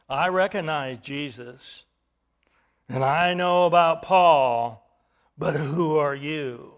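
lightly voiced spirit, low and slow; evil spirit. Evil spirit answering the would-be exorcists, low and deliberate.

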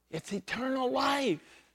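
usual speaking voice

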